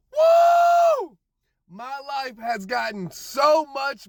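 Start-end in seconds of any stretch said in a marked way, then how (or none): 0.1-1.2 high, loud whoop of joy; joyful whoop. Real, not acted. Celebrating a year sober on the ride home, big high whoop. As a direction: let out a big celebratory woo.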